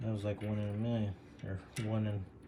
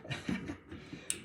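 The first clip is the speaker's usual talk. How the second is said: soft laugh; 8 dB quieter than usual. Real, not acted. Laughs after botched oops.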